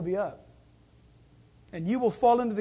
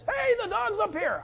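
calling out; 10 semitones higher than usual. Retold or imitated. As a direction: imitate a neighbor hollering down the street. Imitates neighbor calling out.

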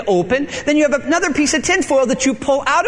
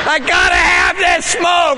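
desperate craving; 7 semitones higher, 3 dB louder, slower than usual. Retold or imitated. Craving smoker pleads.

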